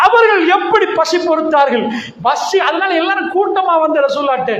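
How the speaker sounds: usual speaking voice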